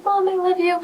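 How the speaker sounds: put-on high, sugary little-girl voice to mom; suddenly all sweet, like a child making up; sweet apology to mom. Sudden switch after lashing out.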